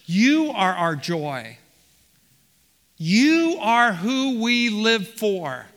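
slow, emphatic declaration leaning on "You"; emphatic stress on you. Acted. Preacher as Paul telling the church they matter, emphatic, drawn-out "You". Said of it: "he repeats it emphatically".

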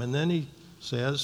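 ordinary talk

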